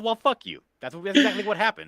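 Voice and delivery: defiant fuck you. Retold or imitated. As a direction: spit it back at them. Ranting at imagined accuser.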